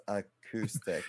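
softly retry "acoustic" as uh-KOO-stick; "acoustic" sounded out with coup; painstaking a-COO-stic; careful "acoustic". Shown as a demonstration. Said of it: "try one more time".

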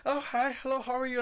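high, sugary fake-nice greeting; high bright fake customer-service voice; fake cheery cashier. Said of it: "looked at me like".

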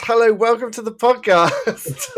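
high, loud hammed-up host greeting; mock podcast welcome. Podcaster greets listeners late after a long tangent, mock-grand welcome. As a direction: announce it big and bright, about to laugh.

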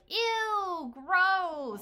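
high, loud drawn-out disgust; mock children's loud "ew" at parents kissing; grossed-out kids. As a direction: squeal it like an embarrassed kid. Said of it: "my kids to be like".

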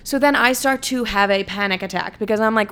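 usual speaking voice

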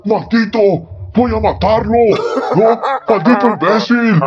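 dramatic furious outburst. Retold or imitated. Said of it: "Denle un Oscar a este diablillo".

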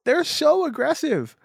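high-pitched exaggerated protest; hammed-up whine about being picked on; mock complaint. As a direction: complain in a high, put-upon voice. Mock complaint about attacking Pokemon.

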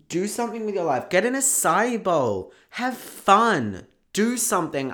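loud, high, fired-up pep talk; real burst of excitement, pushing listeners to get moving; really excited. Real, not acted. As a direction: urge them on with mounting excitement. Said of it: "Just got really excited".

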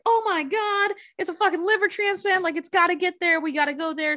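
high, urgent mock-panicked passengers. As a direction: play the whole bus freaking out. Bus passengers panicking, voiced.

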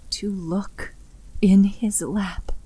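plain reading voice

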